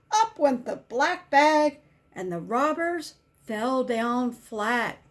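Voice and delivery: usual speaking voice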